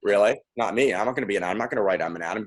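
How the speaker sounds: sulky low mutter of someone refusing to play along; bored refusal. Retold or imitated. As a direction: play the reluctant bored participant. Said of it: "people get a little bored".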